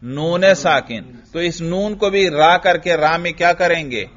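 ordinary talk, nothing marked

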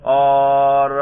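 usual speaking voice

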